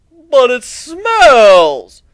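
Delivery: slow stretched whine, high and loud; high drawn-out whine; whiny pony. Acted. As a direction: complain like a spoiled snob.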